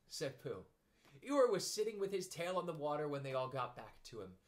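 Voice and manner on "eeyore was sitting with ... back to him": plain reading voice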